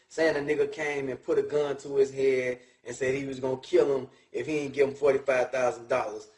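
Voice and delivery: ordinary talk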